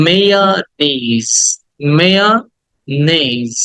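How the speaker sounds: মেয়োনিজ as slow may-uh-NAYZ; correct pronunciation. Shown as a demonstration. Teacher models the word for students, slow careful pronunciation. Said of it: "কিন্তু উচ্চারণটা হচ্ছে".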